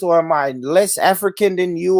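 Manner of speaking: ordinary talk, nothing marked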